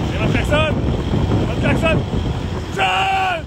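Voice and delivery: shouting a name across distance; calling out. Real, not acted. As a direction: call out loud and high. Calling out to John.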